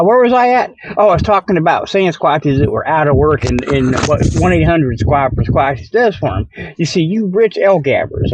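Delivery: ordinary talk, nothing marked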